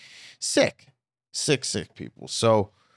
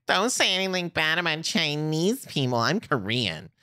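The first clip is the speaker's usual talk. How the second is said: mocking voice; 8 semitones higher than usual. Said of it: "goes around being like".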